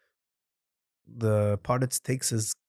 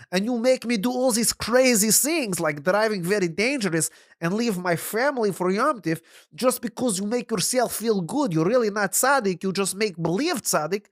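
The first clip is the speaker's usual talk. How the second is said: put-on higher, earnest broken English with Yiddish accent; simple wagon driver, Yiddish accent; 10 semitones higher, 3 dB louder than usual. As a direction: reason it out plainly, Yiddish accent.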